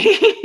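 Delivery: a high, bubbling laugh that breaks out; high burst of laughter. Real, not acted. Laughing at her friend's joke, high giggle.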